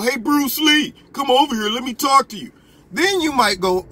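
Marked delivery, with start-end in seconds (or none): 0.0-2.5 mock call. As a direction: call him over with a cheeky grin. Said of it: "and I go".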